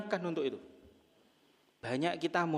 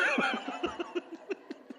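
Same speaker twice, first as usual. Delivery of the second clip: breathy laugh; 4 dB quieter than usual. Real, not acted. Joke about last wishes.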